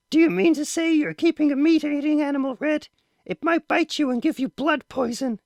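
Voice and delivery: very high, anxious mother's voice; shrill with worry for her son; alarmed mother. A narrator's reading. Mother frightened about meat-eating pet, very high.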